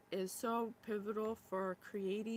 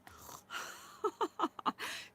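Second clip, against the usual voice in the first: whispered laugh through tears; teary breathy laugh; 9 semitones higher than usual. Real, not acted. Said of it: "Look, I'm crying".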